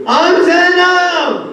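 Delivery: high, loud, drawn-out cry; wailed cry. Acted. Zainab answering her husband in a wail. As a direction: stretch the name in grief.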